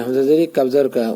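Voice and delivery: usual speaking voice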